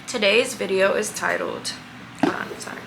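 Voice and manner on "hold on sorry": whispering. Real, not acted. Adjusting camera aside.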